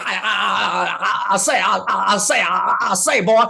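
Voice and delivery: put-on I say, I say, boy, big and silly; Foghorn Leghorn. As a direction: stutter it out like the cartoon rooster. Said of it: "What was the rooster's name?".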